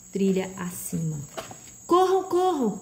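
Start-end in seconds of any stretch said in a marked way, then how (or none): 1.9-2.8 high-pitched urgent cry; urgent shout. A narrator's reading. A mysterious voice urges them to flee, shouting. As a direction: call out high to make them run. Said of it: "a voz repetiu".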